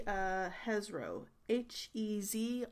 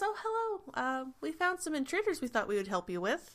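higher-pitched, casual greeting in character; lightly voiced crew leader; 6 semitones higher, faster than usual. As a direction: lightly play the arriving guard leader. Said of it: "She goes".

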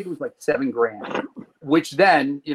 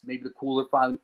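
normal talking voice

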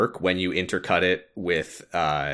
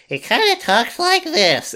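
squeaky, very high little critter voice; Chirithy; 4 dB louder than usual. Retold or imitated. Podcaster showing co-host how the kitty-cat critter talks, squeaky high voice. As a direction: put on a tiny, high-pitched cartoon creature voice. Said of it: "get used to Chirithy's voice".

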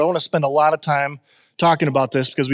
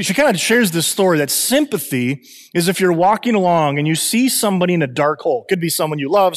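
usual speaking voice

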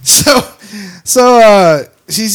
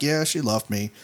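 low, much softer mournful voice; mock mopey Wayne; 10 semitones lower, 12 dB quieter than usual. Wayne tells the drunk woman he was dumped, low mope. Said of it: "And he's like".

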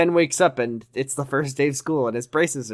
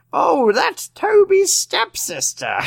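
put-on very high, slow valley-girl drawl; hammed-up teen girl; high school teen girl voice; 4 dB louder, much slower than usual. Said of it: "My grand high school teen girl voice".

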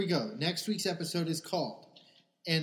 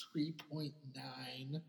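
whispering; 2 semitones lower, 9 dB quieter, much slower than usual. Real, not acted. Shy low rating.

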